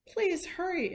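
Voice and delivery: high, pleading plea; desperate plea for help; begging. A narrator's reading. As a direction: beg urgently. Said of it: "Imogene begged".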